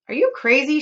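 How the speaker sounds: high, loud scornful shout. Acted. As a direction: scoff at the offer aloud. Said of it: "shouted a woman".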